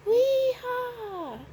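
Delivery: high, whooping yell; high-pitched character shout. A narrator's reading. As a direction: holler it like a gleeful whoop. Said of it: "shouted the March Hare".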